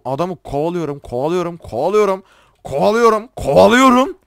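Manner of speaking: building irritation. Real, not acted. Gamer retelling a long chase, increasingly annoyed. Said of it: "Gerçekten acayip sinir oldum".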